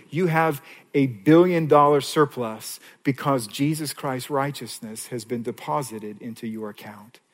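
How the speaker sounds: normal talking voice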